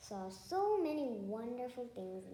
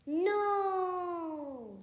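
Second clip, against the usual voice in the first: high, loud panicked scream of no; 8 semitones higher, 10 dB louder than usual. A narrator's reading. Pig Poppleton refusing fifteen kinds of red, screaming. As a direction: cry out no in sudden panic. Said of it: "screamed Poppleton".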